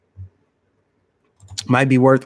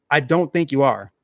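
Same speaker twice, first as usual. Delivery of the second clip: normal talking voice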